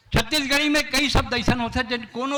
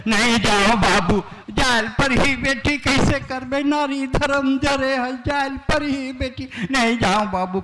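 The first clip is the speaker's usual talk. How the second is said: hammed-up sobbing plea, higher; mock wailing bride; 5 semitones higher than usual. Comic poet mimics the bride's farewell wailing. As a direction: wail like a departing bride, half in jest. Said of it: "कठल कठल के रोवे वह".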